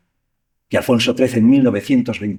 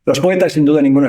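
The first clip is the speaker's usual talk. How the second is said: ordinary delivery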